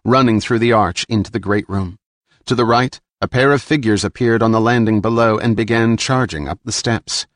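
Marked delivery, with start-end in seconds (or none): none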